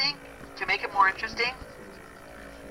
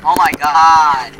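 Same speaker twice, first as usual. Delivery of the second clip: slow, loud amazed cry; drawn-out shout of delight; excited; 12 dB louder, much slower than usual. Real, not acted. Rare drop in game.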